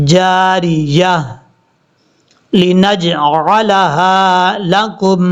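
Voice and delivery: drawn-out chanted tajweed reading; slow Quran recitation. Shown as a demonstration. Teacher reciting verse slowly for students. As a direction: recite slowly, stretching each sound.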